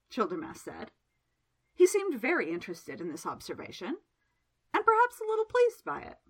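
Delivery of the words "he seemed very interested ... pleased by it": ordinary delivery, nothing marked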